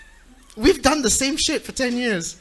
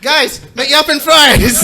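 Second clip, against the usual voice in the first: excited; 5 semitones higher, 9 dB louder, much slower than usual. Acted. Hamming up a show name.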